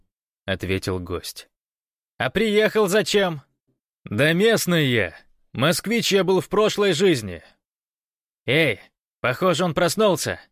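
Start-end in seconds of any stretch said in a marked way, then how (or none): 2.2-3.4 high, loud interrogation; demanding guard's raised voice; shouted question. A narrator's reading. Guard interrogates stranger from afar. 4.1-7.4 loud, high retort; raised voice across a distance; called-out answer. A narrator's reading. Guest calls answer to guards. 8.5-10.4 high, raised call; excited loud shout; shouted news. A narrator's reading. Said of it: "крикнули из-за баррикады".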